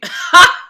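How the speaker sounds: very loud, high cackle; shrieking laughter; hysterical laugh. Real, not acted. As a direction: burst out laughing at full volume. Amused by the copied cat.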